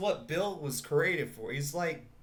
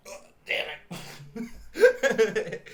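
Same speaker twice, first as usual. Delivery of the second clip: groaned, higher burst of annoyance; mock frustration; 8 semitones higher, 7 dB louder, much slower than usual. Customer refused his sauce, hammed-up frustration. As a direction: blurt it out, ending loud.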